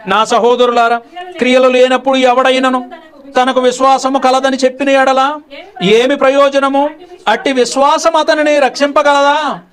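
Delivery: slow, high, loud scripture recitation; solemn scripture reading. Acted. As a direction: intone the verses slowly like a preacher. Reads scripture aloud in sermon. (నెమ్మదిగా, గంభీరంగా లేఖన పఠనం)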